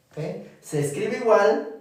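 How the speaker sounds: normal talking voice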